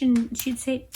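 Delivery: ordinary delivery, nothing marked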